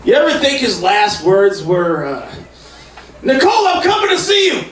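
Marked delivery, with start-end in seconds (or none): 3.2-4.6 put-on high, shouted old-man cry; O J as Fred Sanford. Comic voicing O J as Fred Sanford, high shout. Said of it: "that was O J as Fred Sanford".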